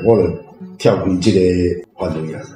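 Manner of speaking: ordinary delivery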